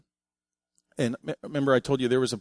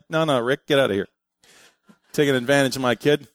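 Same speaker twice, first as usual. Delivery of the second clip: mock scolding; 6 dB louder, faster than usual. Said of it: "I'm like".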